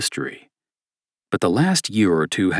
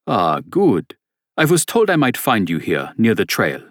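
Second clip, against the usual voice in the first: put-on thick German accent; 3 semitones higher than usual. German stranger relieved to find the priest, thick German accent. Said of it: "said a man with a thick German accent".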